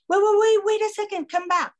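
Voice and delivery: calling out high and loud, wait wait; the woman shouting after her to come back; urgent call. Retold or imitated. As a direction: call her back before she's gone.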